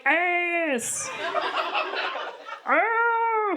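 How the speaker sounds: high, drawn-out slow-motion word. Acted. Comic teasing a friend with a little joke, in slow motion. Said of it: "talk in slow motion when I talk about ass".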